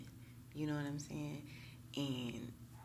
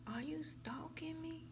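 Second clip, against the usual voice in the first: high, slow imitated question; mock incredulous quote; 12 semitones higher, slower than usual. Retelling a reader's accusing message, high mock incredulity.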